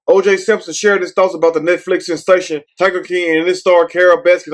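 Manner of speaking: ordinary delivery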